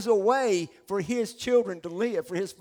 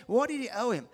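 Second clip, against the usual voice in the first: ordinary delivery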